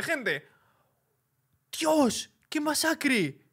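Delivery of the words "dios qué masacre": high-pitched amazed exclamation; squeaky disbelief; shocked awe. Real, not acted. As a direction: react aghast at the carnage. Reacts to gory kill in game.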